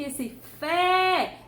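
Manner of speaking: "esse fé" with a long, emphatic "fé"; lengthened syllable. Shown as a demonstration. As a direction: stretch the vowel of "fé". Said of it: "demorou mais tempo".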